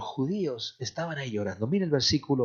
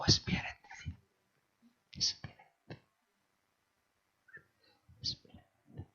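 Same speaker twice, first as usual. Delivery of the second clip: whispered affirmation, fading; 9 dB quieter than usual. Shown as a demonstration. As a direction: whisper it, each repeat softer. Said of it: "díselo".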